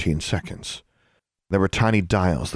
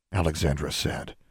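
plain reading voice

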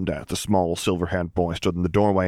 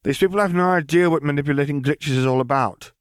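put-on higher, matter-of-fact girl's voice; girl brushing off talk of a dead lord, higher; young girl Lily; 12 semitones higher than usual. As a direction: say it lightly, as if it's nothing.

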